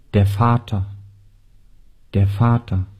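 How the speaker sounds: ordinary delivery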